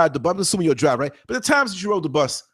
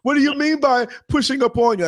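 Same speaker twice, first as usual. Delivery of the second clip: mock-innocent question, higher than his own voice; passenger, acting innocent; 5 semitones higher than usual. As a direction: play dumb, like a pushy rider faking surprise. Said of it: "this is how I said it without saying it".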